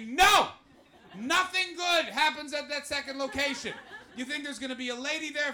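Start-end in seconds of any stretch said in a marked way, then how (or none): 0.1-3.7 mock shout. Warning Jack off late nights.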